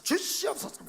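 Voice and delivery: high-pitched, urgent prayer plea; fervent pleading. Retold or imitated. Recalling a secret prayer under the table, high fervent pleading. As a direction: beg God for it, pitch leaping up. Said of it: "간절히".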